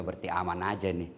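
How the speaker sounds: husband's quiet inner voice, reassured; inner thought. Retold or imitated. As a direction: mutter it like a private thought. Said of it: "Dalam hati suaminya".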